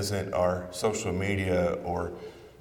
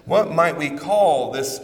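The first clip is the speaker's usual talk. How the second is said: a louder, swelling sermon question; rising preacher's question; 5 semitones higher, 6 dB louder than usual. Acted. Preacher asking Jesus to name the season, swelling louder. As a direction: build the question as you near its answer.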